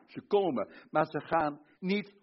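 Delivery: usual speaking voice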